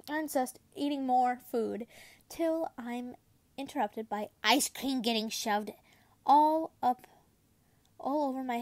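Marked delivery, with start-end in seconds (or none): none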